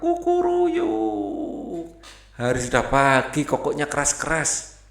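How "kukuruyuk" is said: very high, slow rising cock-a-doodle-doo; rooster crow. Pretend. Rooster on the fence crowing at the moon, very high and slow. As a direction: crow long from the fence top. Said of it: "dia melompat ke atas pagar".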